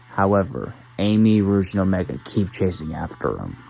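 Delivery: ordinary delivery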